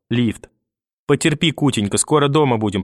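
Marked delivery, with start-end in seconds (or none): none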